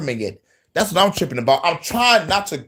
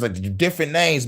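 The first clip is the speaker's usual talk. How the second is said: ordinary talk, nothing marked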